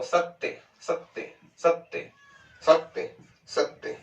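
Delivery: सत्य whispered slowly as सत्-त्य with the त doubled; whispered demo. Shown as a demonstration. Teacher whispers the word for the students: सत्य, त doubled. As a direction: whisper सत्य and let the त sound twice. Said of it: "तो क्या बोलोगे इसको?".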